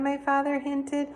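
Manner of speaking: ordinary delivery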